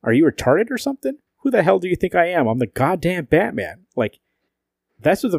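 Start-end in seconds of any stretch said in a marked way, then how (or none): 0.0-3.9 pitched up, jeering rant; mocking angry Batman. Reading Batman's rude lines to the hosts, a jeering mock rant. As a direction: sneer it like a cocky bully. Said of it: "He goes".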